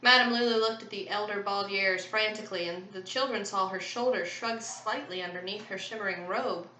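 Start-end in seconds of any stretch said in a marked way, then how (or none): none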